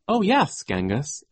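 high, playful cry on the opening words; mock-joking cry. A narrator's reading. Violet pretends to joke with Genghis, joking cry. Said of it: "as if she were joking".

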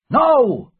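loud, high cry of refusal. A narrator's reading. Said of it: "cried George Merry".

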